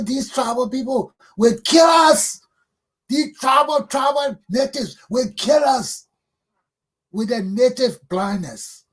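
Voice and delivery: high, loud, drawn-out outcry; real indignant shouting; impassioned shout. Real, not acted. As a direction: raise the alarm, stretching the words out.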